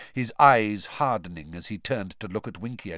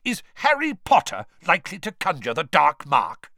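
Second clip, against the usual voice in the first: loud, high angry rebuke; 7 semitones higher, 6 dB louder than usual. A narrator's reading. Mister Weasley rebuking Diggory, loud and angry. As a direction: snap back at him angrily. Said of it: "very angrily".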